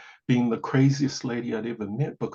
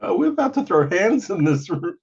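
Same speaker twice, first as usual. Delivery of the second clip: raised, louder comic indignation; mock affronted threat; 7 semitones higher, 6 dB louder than usual. Storyteller joking about squaring up to the questioner, mock outraged. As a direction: play up being ready to fight.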